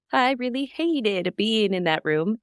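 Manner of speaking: lilting, slow sing-song; sing-songy voice. Retold or imitated. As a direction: sing it out lightly and slowly. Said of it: "in kind of a sing-songy voice".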